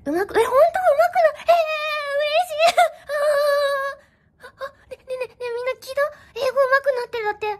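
高い声で感極まって喜ぶ; 泣きそうなほど嬉しそうに; 大喜び. Real, not acted. (歓喜で声が裏返る)

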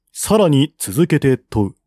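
plain reading voice

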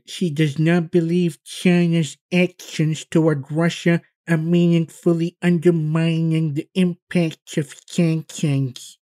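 lightly mocking official tone; mock Yellen quote. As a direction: mimic her with a slow, high sneer. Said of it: "Treasury Secretary Janet Yellen, who said".

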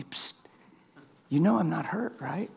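hushed and gentle, nearly breath; gentle whisper. Retold or imitated. The Lord whispering to the praying preacher, hushed. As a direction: lean in and whisper it kindly. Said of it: "the Lord whispered to my heart".

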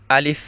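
আলিফ said clearly, a breath of air at the end; alif demo. Shown as a demonstration. Teacher showing students the letter, demo. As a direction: pronounce আলিফ with a light puff out. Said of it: "আমি যেভাবে উচ্চারণ করব".

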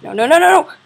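loud high panicked no's; panicked yell. Real, not acted. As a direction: scream it out, losing. Dying to the monster.

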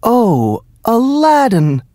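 high excited cry; mother's joyful cry; cried out. A narrator's reading. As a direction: call out his name in delight. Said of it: "cried his mother".

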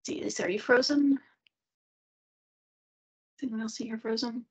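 ordinary talk, nothing marked

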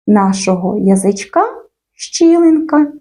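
ordinary talk, nothing marked

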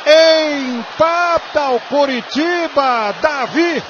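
rising to a shouted goal call; explosive goal commentary. Acted. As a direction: build to a roaring goal. Narrator calls the penalty and goal.

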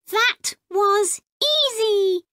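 chirpy tiny robot, proud of itself; small robot voice. Acted. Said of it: "the robot says".

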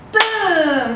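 long, falling firework boom; booming sound word for kids; demonstrated boom. Shown as a demonstration. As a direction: draw out the boom like a firework going off. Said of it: "Let's try it one more time".

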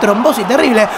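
ordinary delivery

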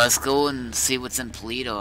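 normal talking voice

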